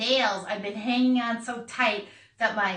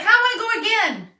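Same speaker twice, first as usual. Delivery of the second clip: high, louder eager declaration; eager announcement; 11 semitones higher, 7 dB louder, faster than usual. Retold or imitated. [name] telling the crew she'll jump again, high eager. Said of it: "And I was like".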